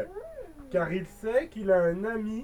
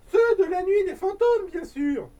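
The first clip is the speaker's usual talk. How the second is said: worried mother; 8 semitones higher, 5 dB louder than usual. Acted. Mother voiced in story.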